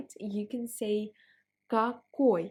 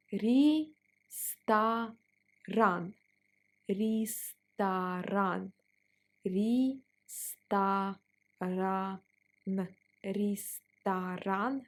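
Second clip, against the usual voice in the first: very slow, drawn-out ресторан; slow teacher drill; slow syllable by syllable; much slower than usual. Shown as a demonstration. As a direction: stretch out ресторан slowly for learners. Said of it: "Let's pronounce it slower".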